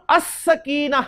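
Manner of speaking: अस-सकीना with no L, doubled S; silent lam. Shown as a demonstration. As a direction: glide from the A straight into a held S in अस-सकीना. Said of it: "इन दो लफ्जों में मैं लाम नहीं पढ़ रहा".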